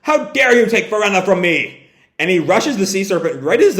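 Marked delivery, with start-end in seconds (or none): 0.0-1.9 higher, acted outraged yell; mock-dramatic cry of fury; indignant yell. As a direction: confront the one who took someone dear. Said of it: "and yells".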